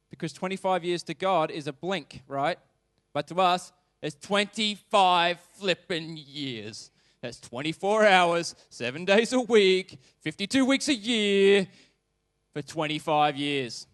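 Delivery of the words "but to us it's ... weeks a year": louder and slower, hammering each phrase; emphatic build. Real, not acted. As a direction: drive home how long the wait was. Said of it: "preacher stresses the long wait".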